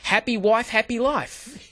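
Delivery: cheerful high-pitched giving in; eager agreement. Retold or imitated. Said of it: "I go".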